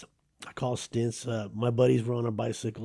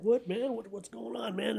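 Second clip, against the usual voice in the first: much higher, startled and pleading; a kid suddenly slammed against a wall; high panicked what-is-happening; scared kid; 10 semitones higher, 5 dB quieter than usual. Retold or imitated. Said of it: "I'm like".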